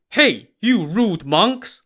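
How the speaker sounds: loud, high, drawn-out holler. A narrator's reading. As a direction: holler at them in indignation. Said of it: "Lu Zhishen hollered at them".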